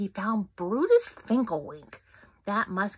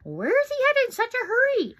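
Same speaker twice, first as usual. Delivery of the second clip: high, loud squeaky squirrel; excited little squirrel; 13 semitones higher, 8 dB louder than usual. Acted. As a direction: ask it in a high chattering voice. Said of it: "asked Frizzy".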